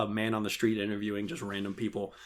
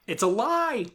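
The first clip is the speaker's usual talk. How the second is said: high, loud exclamation; mock outraged cry; 12 semitones higher, 4 dB louder, slower than usual. Real, not acted. Calling Beatles praise a lie, loud high cry. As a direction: yell it up high. Joking outburst at Beatles fans.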